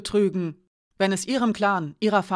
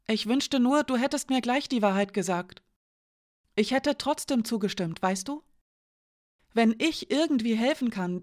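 put-on higher, disbelieving young woman; Janka's voice; 5 semitones higher than usual. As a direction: voice Janka higher, shaken and accusing. Janka confesses, tearful.

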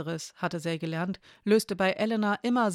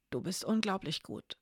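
softer, barely voiced breath of praise; almost toneless whisper; 5 dB quieter than usual. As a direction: breathe it out nearly without voice. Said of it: "flüsterte sie, nur fast tonlos". (fast tonloses Flüstern)

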